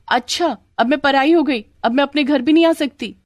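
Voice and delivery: high, hurt complaint; indignant protest. Acted. Sandhya to her family from the wall, indignant.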